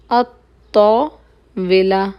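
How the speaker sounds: अत्ताविला drawn out very slowly with a doubled t; slow careful pronunciation. Shown as a demonstration. Teacher slowly spelling out अत्ताविला for students. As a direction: stretch each sound of अत्ताविला so students can copy it. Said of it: "अल ताविला नहीं होगा".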